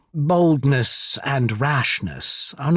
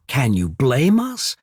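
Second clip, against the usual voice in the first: ordinary talk, nothing marked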